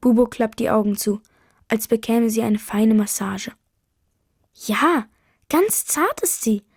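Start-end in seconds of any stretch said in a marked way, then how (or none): 4.5-6.6 high, drawn-out delight. A narrator's reading. Mother stroking the owl on the neighbour's shoulder, delighted. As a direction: marvel slowly, pitch lifting high. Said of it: "sagt Mama begeistert".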